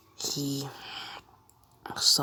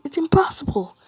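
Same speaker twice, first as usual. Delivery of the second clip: high, loud cry of disbelief; acted panic, pitched far up; panicked exclamation; 12 semitones higher, 8 dB louder, faster than usual. All Might cannot lift the hammer.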